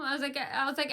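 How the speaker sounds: ordinary talk, nothing marked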